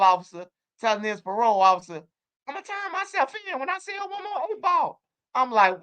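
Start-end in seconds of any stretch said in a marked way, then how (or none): 2.5-5.0 very high, soft squeaky puppet voice; cartoon teddy bear. Jeffy telling his parole officer, high cartoon voice. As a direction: plead in a high cartoon falsetto.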